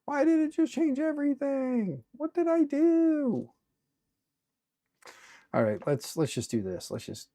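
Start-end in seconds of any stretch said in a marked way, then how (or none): 0.1-3.5 high, loud, stretched out in disbelief; exasperated disbelief. Real, not acted. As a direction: cry out in frustrated confusion. Software changed every color.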